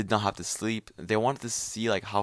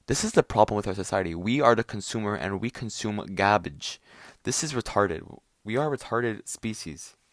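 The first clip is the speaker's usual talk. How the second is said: usual speaking voice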